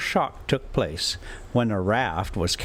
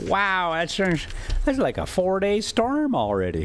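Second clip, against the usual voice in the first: higher, amazed aside; real amused surprise; surprised; 8 semitones higher than usual. Real, not acted. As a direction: marvel at the storm's length. Reader reacts to the story.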